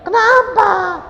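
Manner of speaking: very high, loud question; incredulous shout. Retold or imitated. Husband demanding a reason from his wife, incredulous shout.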